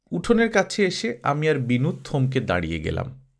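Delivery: ordinary delivery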